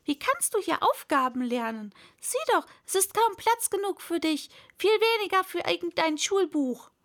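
brisk, rising telling-off; self-reproach that climbs in pitch; scolding herself. A narrator's reading. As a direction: lecture yourself impatiently. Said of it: "schalt sie sich selbst".

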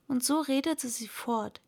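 usual speaking voice

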